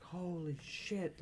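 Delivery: soft, high, drawn-out mock awe; drawn-out pretend amazement; retold amazed audience. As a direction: imitate a stunned crowd, slowly and softly. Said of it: "the whole fucking theater goes nuts".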